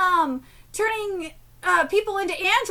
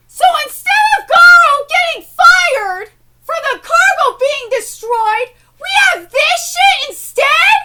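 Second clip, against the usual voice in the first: outraged shout; 9 semitones higher, 9 dB louder than usual. Real, not acted. Outraged at manga retcon.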